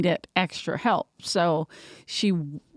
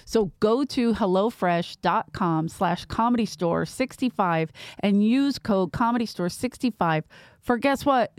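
normal talking voice

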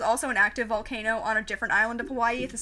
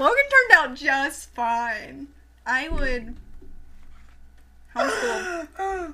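slow, drawn-out mock approval; mock solemn; 3 semitones higher, 4 dB louder, much slower than usual. Teasing the homeschooled co-host, slow mock solemn. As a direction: stretch it out, teasing.

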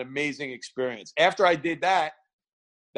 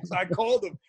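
ordinary talk

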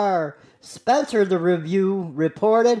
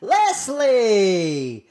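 ordinary talk

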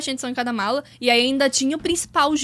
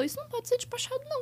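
stern clerk; 11 semitones higher, 11 dB quieter, faster than usual. Retold or imitated. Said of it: "a mulher olhou pra aquilo e falou".

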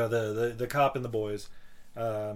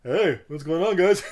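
louder, bright customer greeting; gas station attendant voice; 4 semitones higher, 7 dB louder than usual. Retold or imitated. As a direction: greet them like a cheery attendant. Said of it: "he's basically just like".

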